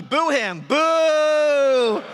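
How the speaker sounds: loud high boo at the dummy; mock boo. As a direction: jeer it out big. Crowd-led booing of dummy.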